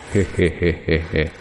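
staccato ghost chuckle; eerie ghostly laugh. A narrator's reading. Spirits taunting the frightened wife, eerie laughter. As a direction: chuckle eerily in separate pulses. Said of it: "nhiều tiếng nói cười đầy ma mị".

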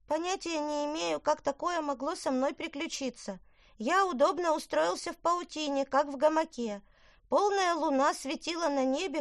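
a small sheepish guard explaining; meek high voice. Acted. Voices the sleepy Sparzhinzon. (робкий тонкий голосок)

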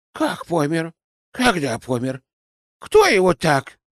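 a crone suddenly sharp and insistent; alarmed old crone. Acted. Yaga demands answers.

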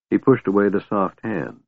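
ordinary delivery, nothing marked

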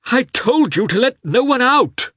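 high, loud scolding shout; angry shout. A narrator's reading. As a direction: yell at the doorkeeper. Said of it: "he shouted to the doorkeeper".

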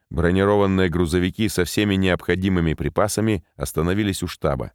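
usual speaking voice

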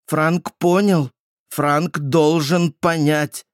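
high, slow, odd brother voice; lightly voiced strange child; Andesh's voice. A narrator's reading.

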